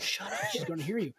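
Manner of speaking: hushed mock scolding; imitated hushing wife. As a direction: shush someone under your breath, acting it out. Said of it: "my wife's going".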